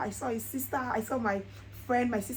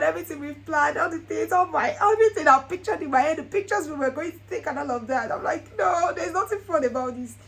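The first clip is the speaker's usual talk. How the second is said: mock complaint; 5 semitones higher, 5 dB louder than usual. Retold sulky complaint.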